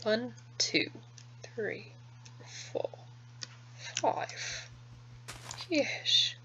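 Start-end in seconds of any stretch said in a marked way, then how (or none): 1.5-6.3 颤巍巍的老头嗓音; 压着嗓子装老头; very old man. Acted. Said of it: "The, the old man".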